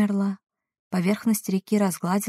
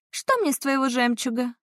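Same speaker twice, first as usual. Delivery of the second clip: scoffing; 6 semitones higher than usual. Acted. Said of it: "фыркнула русалка".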